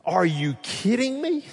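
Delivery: me rising high in disbelief; second take, stronger disbelief; incredulous. Acted. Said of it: "pastor's disbelief, repeated".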